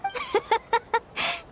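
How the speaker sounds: a big bright laugh breaking out; amused laugh at the surprise; hearty laugh. Real, not acted. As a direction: laugh it off warmly.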